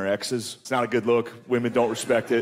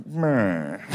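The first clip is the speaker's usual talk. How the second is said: lightly voiced old announcer; 6 semitones lower than usual. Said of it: "You can hear the announcers like".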